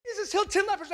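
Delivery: high-pitched, excited announcement; hyped up. Shown as a demonstration. Preacher imagining himself as the writer, hyping the miracle. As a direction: hype it like astonishing news. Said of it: "I'm hyping this".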